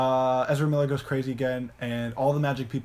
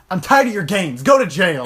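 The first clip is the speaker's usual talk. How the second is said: high, loud furious yell; angry shout; 5 semitones higher, 10 dB louder than usual. Retold or imitated. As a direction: blow up and order them gone. Vader parody loses temper.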